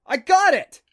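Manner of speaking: loud, high-pitched yell of success; full-voiced, high exclamation; triumphant yell. A narrator's reading. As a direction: yell it out urgently, breakthrough made. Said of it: "she yelled".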